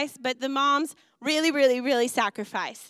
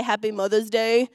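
1 imitating Pastor Andy; 3 semitones lower than usual. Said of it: "as Pastor Andy would say".

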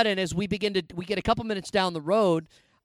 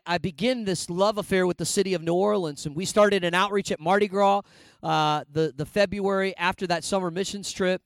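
ordinary talk

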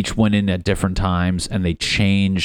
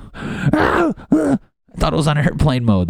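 caught off guard; 9 semitones higher than usual. Pretend. Caught naked at home.

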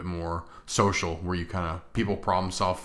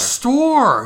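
normal talking voice

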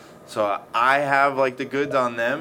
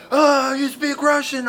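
way higher and slower, a surprised Russian biker imitated; mock Russian biker; 8 dB louder, slower than usual. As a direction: pitch it way up and stretch it, like a stunned Russian biker. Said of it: "And they're like".

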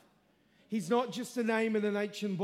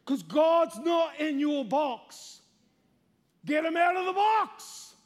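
preaching shout; 5 semitones higher, 6 dB louder, slower than usual. Real, not acted. Preacher challenging the congregation.

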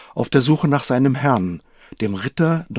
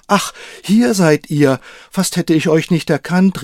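relieved exclamation; 6 semitones higher, 4 dB louder than usual. Acted. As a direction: call out, glad to find him at last. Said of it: "rief er erleichtert aus".

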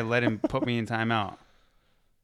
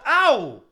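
high loud ow of pain; pained yelp; 11 semitones higher, 5 dB louder than usual. Real, not acted. Hair plucked.

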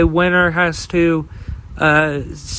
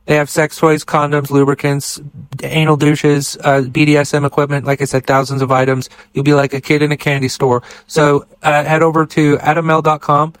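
brisk, punchy sponsor read, fast and a bit louder; 3 dB louder, faster than usual. Acted. Podcast host pitching sponsor to listeners, quick upbeat ad read. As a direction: rattle off the plug like a radio spot.

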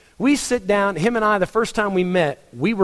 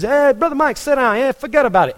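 put-on higher, louder New York Yankee accent; imitating a New York accent, higher and louder; Joe Costa's New York accent; 9 semitones higher, 3 dB louder than usual. New York pastor greeting his friend, imitated New York accent. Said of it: "I could listen to that accent for hours".

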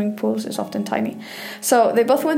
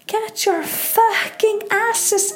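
high, slow, furious shout in a foreign voice; furious screaming Icelandic woman; 11 semitones higher, slower than usual. Retold or imitated. Angry bather screaming at tourists to shower, furious high shout. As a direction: bellow orders at the girls like an outraged Valkyrie. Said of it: "This is what she screamed at".

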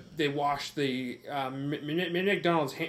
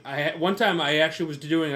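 ordinary talk, nothing marked

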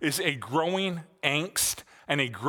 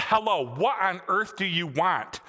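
higher, slow exasperated roar; mock roar; 5 semitones higher than usual. Furious man answering a third prank call, mock roar. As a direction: roar into the phone in stretched-out exasperation. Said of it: "a voice roared on the other end".

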